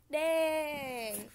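loud, drawn-out wail of frustration; upset outburst. Real, not acted. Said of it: "I'm really upset about these carrots".

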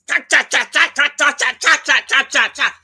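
loud staccato "cha cha cha"; harsh nagging chatter. Shown as a demonstration. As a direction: imitate sharp nagging with loud choppy syllables. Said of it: "kind voice, not".

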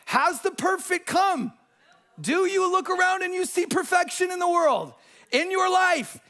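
high, drawn-out emphatic preaching; impassioned preaching. Acted. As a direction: press each question home to the congregation. Preaches at full force.

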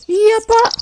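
eerie high ghostly reply. Acted. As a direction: answer like a spooky maid ghost. Ghost maid answers.

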